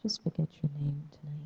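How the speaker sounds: hushed whisper. Acted. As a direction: whisper it gently. Meditation instruction.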